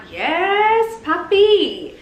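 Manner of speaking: high, slow, cooing love talk to a crush; swooning coo. Retold or imitated. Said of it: "I'm like".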